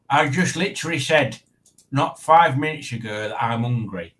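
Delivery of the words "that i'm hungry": ordinary talk, nothing marked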